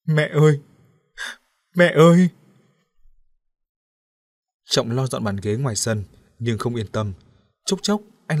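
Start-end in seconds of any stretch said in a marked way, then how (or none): 0.1-2.3 louder, higher wailing cries; an exhausted boy wailing for his mother; hoarse wailing. A narrator's reading. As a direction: cry out, rising to a howl. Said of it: "kêu gào khàn tiếng".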